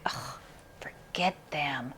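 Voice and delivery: breathy whispered brush-off, slow; dismissive whisper. Retold or imitated. Viewers who dislike the band, a slow dismissive whisper. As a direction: whisper it like you're waving them off. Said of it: "some of you are just gonna be like".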